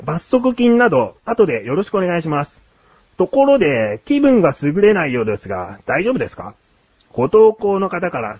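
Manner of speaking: story narration. A narrator's reading. Host telling co-host of a roadside proposal, story narration. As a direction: narrate it like a feature news tale.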